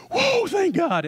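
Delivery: Isaac's startled, high-pitched relief; relieved boy. Retold or imitated. Isaac spared on the altar, high squeaky relieved whoa. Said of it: "Isaac at that moment is thinking".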